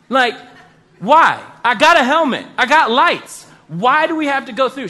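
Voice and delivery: mock outrage, pushing back at the guide; exasperated outburst.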